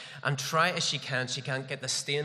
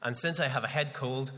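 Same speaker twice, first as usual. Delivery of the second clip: normal talking voice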